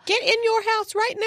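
high mock shrill command; mock yell. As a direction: play the panicked woman ordering the dog. Listener mocks the yelling woman.

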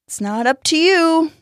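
high, emphatic, haughty put-down; proud. A narrator's reading. Fletcher putting Max in his place, proud. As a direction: lift the voice to a proud finish. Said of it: "Fletcher said proudly".

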